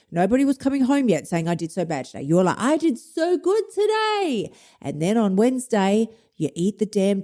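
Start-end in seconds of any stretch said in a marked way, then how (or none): 1.5-2.2 low, soft confession; mock dejection. Dieter who nobody hears coming home, low mock-glum admission. Acted homecoming report, against good version. 2.6-4.5 high, slow sing-out of pride; proud boasting. Acted. Dieter coming home after a good day, high delighted boast. Acted proud homecoming report.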